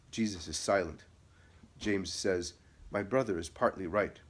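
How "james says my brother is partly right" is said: plain reading voice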